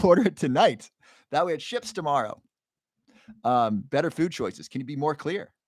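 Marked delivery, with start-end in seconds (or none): 0.0-2.4 laughing. Real, not acted. Jokingly urging viewer to order.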